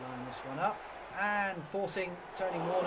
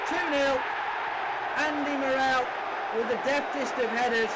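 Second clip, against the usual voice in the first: the ball's in, call it to the whole ground; goal call; 5 semitones higher, 4 dB louder than usual. Real, not acted. Second goal scored.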